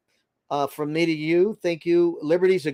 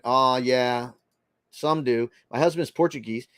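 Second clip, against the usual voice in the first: ordinary talk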